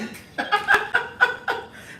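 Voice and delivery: high breathless burst of laughter. Real, not acted. Host laughing at a viewer's message, high and breathless. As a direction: crack up at the chat.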